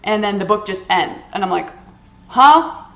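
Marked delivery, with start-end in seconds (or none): 2.3-2.9 loud, higher puzzled huh; baffled huh. Real, not acted. Reacting to the book suddenly ending, loud confused huh. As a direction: call out huh in disbelief.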